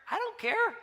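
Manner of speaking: high, airy brush-off of the worry; carefree dad waving it away; dismissive who cares. Retold or imitated. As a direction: shrug it off lightly, pitch way up.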